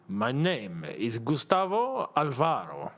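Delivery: rolling the name out slowly in a put-on Spanish accent; a lightly voiced grand introduction, slow; mock Spanish accent. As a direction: savour each syllable in a mock Spanish accent. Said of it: "That sounds fantastic".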